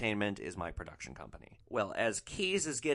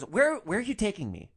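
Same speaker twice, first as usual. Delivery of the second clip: higher, louder imitation of a put-out passenger; acted-out demand, pitched up; indignant passenger; 10 semitones higher, 6 dB louder than usual. Retelling rude passenger's demanding question.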